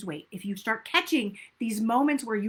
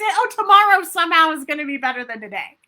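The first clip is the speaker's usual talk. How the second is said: mock wishful thinking; 8 dB louder than usual. Coach mocks the tomorrow excuse, loud and hammed-up. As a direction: ham up the tomorrow-will-be-better excuse. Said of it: "We say".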